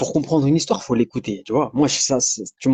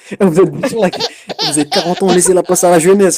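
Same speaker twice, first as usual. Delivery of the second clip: laughing; 5 semitones higher, 6 dB louder than usual. Real, not acted. Mock rant laughing.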